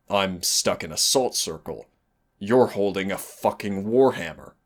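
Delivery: ordinary talk, nothing marked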